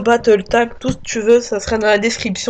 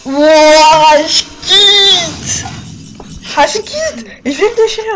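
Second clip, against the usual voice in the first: high loud rage quit; raging gamer; 6 semitones higher, 4 dB louder, slower than usual. Real, not acted. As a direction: explode and quit the game. Rage quits the game. (gamer en rage qui quitte la partie)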